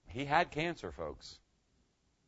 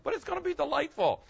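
excited. Real, not acted. Pitch leap on promise.

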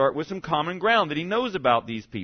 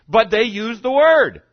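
louder, higher, slow punch on each word; slow, loud and raised for emphasis; emphatic preaching; 6 semitones higher, 9 dB louder, slower than usual. Acted. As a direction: drive the point home slowly. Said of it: "emphatic sermon point".

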